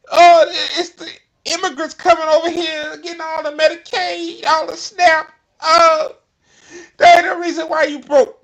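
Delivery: high, loud mocking politician voice; mocking her. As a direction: sneer it out as the congresswoman. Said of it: "watch what she say about".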